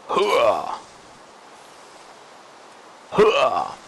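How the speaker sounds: hooah army battle cry. Acted. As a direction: bark it like a drill soldier. Battle cry while fighting.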